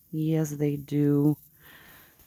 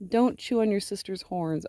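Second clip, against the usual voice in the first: ordinary talk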